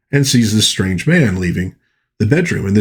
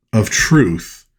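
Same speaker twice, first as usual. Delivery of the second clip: ordinary talk, nothing marked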